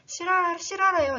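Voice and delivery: high, loud burst of excitement; excited exclaim. Real, not acted. Teacher excitedly announcing the book is true. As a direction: announce it with delight.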